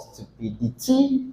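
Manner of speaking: softly, landing high on the TY of "stupidity"; stress on last syllable. Shown as a demonstration. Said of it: "stress every single syllable here".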